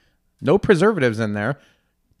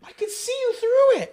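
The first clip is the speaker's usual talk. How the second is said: amazed high voice; 3 dB quieter than usual. Real, not acted. Holding up thin seaweed.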